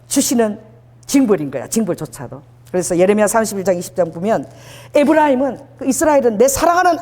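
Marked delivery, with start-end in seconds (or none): none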